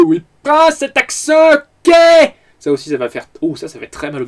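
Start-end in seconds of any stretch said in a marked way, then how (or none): 0.5-1.9 high, loud, hammy overacting; overacted shout. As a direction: ham it up like a farce actor. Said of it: "où il surjoue un petit peu".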